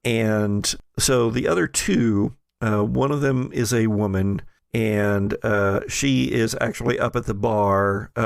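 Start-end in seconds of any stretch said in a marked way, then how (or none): none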